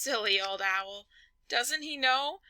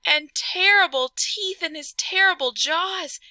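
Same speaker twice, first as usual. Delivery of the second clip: higher, louder alarmed storytelling; 7 semitones higher, 8 dB louder than usual. Acted. Narrator gasping at the monster's claws and teeth. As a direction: build the fright.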